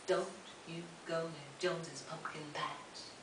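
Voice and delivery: slow, soft, hushed warning; ominous warning. Retold or imitated. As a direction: murmur it slowly like a local legend. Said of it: "people still say".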